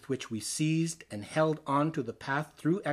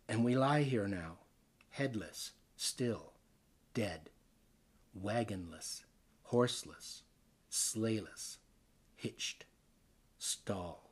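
softer and lower, each word sinking toward silence; hushed fading list; 7 semitones lower, 9 dB quieter than usual. Acted. The dead riders whisper their stillness, hushed and fading. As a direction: let every word die away like a corpse's murmur.

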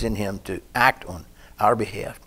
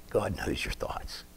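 whisper; 8 dB quieter, slower than usual. Shown as a demonstration. Soft cry, whispered aside.

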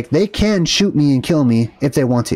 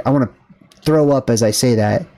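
normal talking voice

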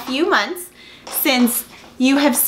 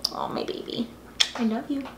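soft cooing; 9 dB quieter than usual. Real, not acted. Cooing at the dog.

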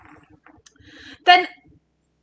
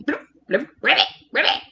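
little bubbly blubs and ribbits; playful pond-frog imitation; frog noises; 4 dB quieter, much faster than usual. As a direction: chirp short ribbits like a frog.

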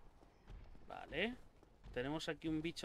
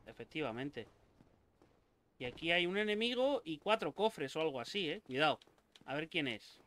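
normal talking voice

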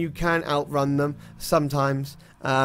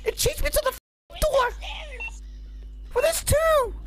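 high breathless panic; scared horror game streamer. Real, not acted. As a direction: still panicked from the chase. Ghost chasing him.

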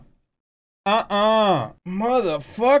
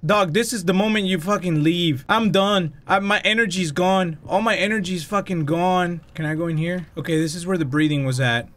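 normal talking voice

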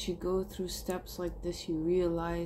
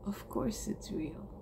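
breathy whisper of certainty; a soft whispered "of course"; 6 dB quieter than usual. Real, not acted. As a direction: whisper it like a private truth. Intimate reassurance to himself.

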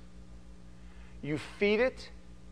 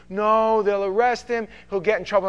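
higher, louder pleading wife; wife begging the pastor not to call police; mock pleading wife; 6 semitones higher, 8 dB louder than usual. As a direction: plead to protect him. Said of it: "And they go".

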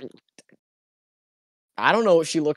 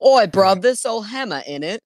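higher-pitched mock British lad; British accent; 7 semitones higher than usual. Joining friend's bit, mock British accent. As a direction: put on a cheeky British accent. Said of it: "we do a little British".

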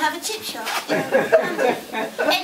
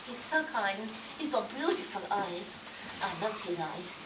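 mock swooning; 6 semitones lower, 11 dB quieter than usual. Swooning over Attenborough.

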